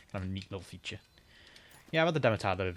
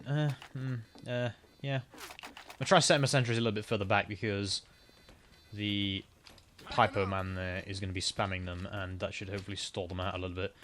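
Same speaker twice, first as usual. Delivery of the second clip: normal talking voice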